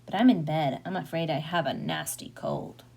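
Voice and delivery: low, scratchy sickbed voice; wolf faking a poorly grandmother, pitched low. A narrator's reading. Wolf in bed claiming a nasty cold, scratchy. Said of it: "came a scratchy voice from the bedroom".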